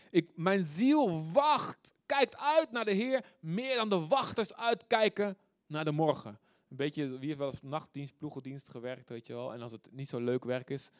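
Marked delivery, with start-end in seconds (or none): 0.1-6.4 raised, higher voice, stretched key words; fervent psalm cry. Acted. Preacher crying out a psalm to his congregation, fervent. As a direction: declaim it loudly with longing, drawn out.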